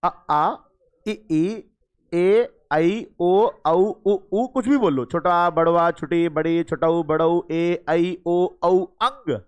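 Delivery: vowels recited in a row ending on nasal अंग; vowel demo. Shown as a demonstration. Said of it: "मैं समझा रहा हूं".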